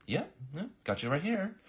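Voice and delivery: high-pitched, obliging clerk voice; helpful receptionist. Acted. Girl finding big guy on clipboard, high obliging confirmation. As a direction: confirm the booking brightly. Girl cheerfully finds booking.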